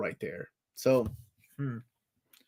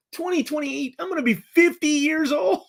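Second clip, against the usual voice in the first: high, incredulous outburst at his own age; shocked disbelief; 12 semitones higher than usual. Real, not acted. Streamer realizing he'll be fifty, shocked and high. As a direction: pitch way up in mock horror.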